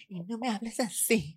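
high mincing voice. Shown as a demonstration. Demonstrating the high voice the psychologist forbade. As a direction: show the way he forbade. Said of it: "me decía".

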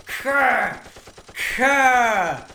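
high, sharp "ka" bursts; imitated action noise. Storyteller shows Rick doing what he does, high mock action noises. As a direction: punch out each noise separately, high.

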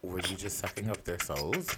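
ordinary talk

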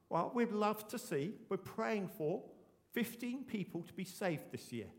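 ordinary talk, nothing marked

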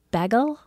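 bagel as a questioning echo, short first vowel; teasing imitation of "bagel?"; mocking query. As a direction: repeat bagel back as a puzzled question. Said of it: "Christopher's always like".

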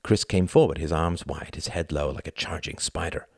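ordinary delivery, nothing marked

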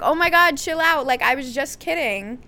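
high, loud start easing off; mock exasperation. Mimics friends reacting to her sarcasm.

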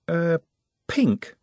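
pause, unsure, before daring to say it; doubtful. A narrator's reading. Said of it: "said Nut doubtfully".

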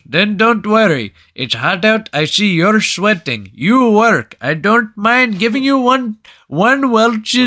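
put-on high, loud, generous Indian-accented shopkeeper. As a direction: imitate an Indian counterman reassuring a customer. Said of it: "the Indian guy behind the counter".

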